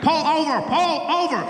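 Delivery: imitated loudspeaker command; police loudspeaker order. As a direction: bark it like police over a speaker. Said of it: "He's like".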